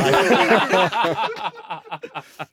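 hearty burst of laughter; loud laugh. Real, not acted. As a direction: belly laugh. Big laugh.

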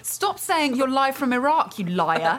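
put-on a touch of Australian accent; angry Australian retort. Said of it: "putting on the Australian".

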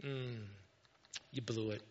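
low, soft, knowing reproach; quiet verdict after a thoughtful hum; Holy Spirit's inner voice. Retold or imitated. Holy Spirit speaking to you on the inside, quiet reproach. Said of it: "Holy Spirit's speaking to you on the inside".

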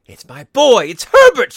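put-on very high, loud frantic old woman; desperate mother's scream; struggling cry. A narrator's reading. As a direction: shriek it, building to a scream. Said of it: "she cried, struggling mechanically".

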